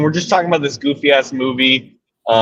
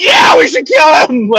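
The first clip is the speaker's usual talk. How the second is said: put-on shrill high little-kid shout, slow and louder; a gang of small kids whooping agreement; kids cheering along; 12 semitones higher, 5 dB louder, slower than usual. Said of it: "they all turn into little kids for a second".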